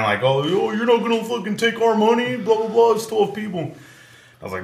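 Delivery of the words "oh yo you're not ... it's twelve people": high-pitched whiny imitation; mock complaining. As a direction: imitate a group griping in a much higher voice. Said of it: "they just start complaining".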